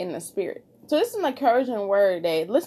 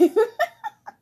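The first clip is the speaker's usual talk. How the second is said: a bright, high burst of laughter; 13 semitones higher than usual. Real, not acted. Podcaster laughing at her own joke, high laugh. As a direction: let a high laugh break out. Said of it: "And I like to laugh".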